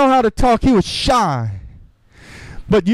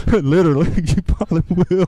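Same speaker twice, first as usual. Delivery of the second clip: quick, lower, chuckled words; laughing aside; 6 semitones lower, faster than usual. Real, not acted. Preacher joking with congregation about getting beat up, laughing. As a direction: talk through your own laughter.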